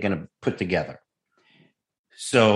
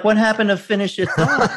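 high, teasing retort through laughter; laughing comeback; 11 semitones higher, 4 dB louder than usual. Retold or imitated. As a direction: throw the line back, cracking up. Throws Pacino's line back, laughing.

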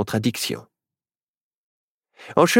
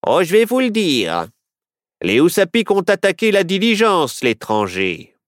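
sheriff voice; 7 semitones higher than usual. A narrator's reading. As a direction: play the sheriff, pitched up and brash. Sheriff character voice.

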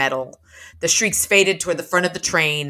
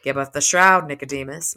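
ordinary talk

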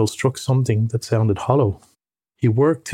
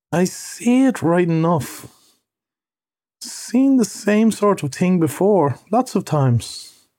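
slow higher voice of an unimpressed mole; slow, deliberate; 6 semitones higher, slower than usual. A narrator's reading. Mole inspecting the find, answering rat slowly. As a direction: take it slowly, pitched up. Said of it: "he said at last slowly".

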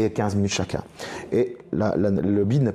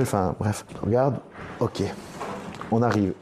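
normal talking voice

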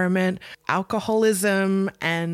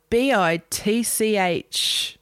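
slow, spelled out in a hammed-up New Zealand accent; 3 semitones higher, much slower than usual. Imitating the director's spelled insult in a New Zealand accent. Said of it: "in her New Zealand accent too".

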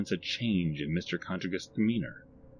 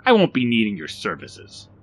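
loud, slightly raised sneer; sneering dismissal; 4 semitones higher, 7 dB louder than usual. A narrator's reading. Barry turns down Rodney's services, sneering. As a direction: dismiss them with scorn. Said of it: "sneered Barry".

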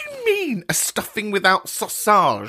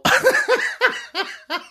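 shrill burst of laughter, high; a high mocking cackle of laughter; high cackling laugh. Real, not acted. Host cackles at caller.

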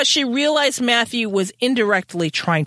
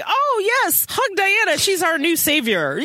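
high, gushing fake worship; mock adoration; 9 semitones higher than usual. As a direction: send up the fawning crowd. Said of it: "everybody's like".

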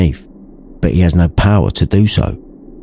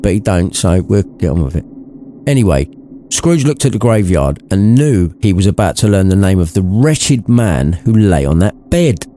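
ordinary delivery

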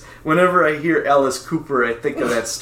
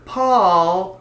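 calling out; 5 semitones higher than usual. Retold or imitated. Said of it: "all I'd hear all night was".

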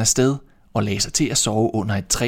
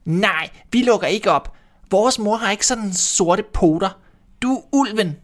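put-on little kids shouting; 10 semitones higher than usual. A narrator's reading. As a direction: squeak it out like small kids refusing. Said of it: "og råbte".

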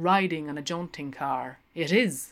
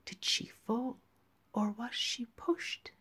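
higher, softer sly whisper; 4 semitones higher, 7 dB quieter than usual. Acted. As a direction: tease her in a hushed voice. Linehan's sly teasing question.

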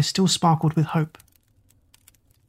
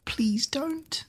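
high, terrified pleading; crying out; 7 semitones higher, 7 dB quieter, slower than usual. Acted. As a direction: beg in panic. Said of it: "the pale girl cried out".

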